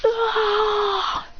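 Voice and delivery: high, held imitated "aah"; mock pain scream. Imitated long cry of pain.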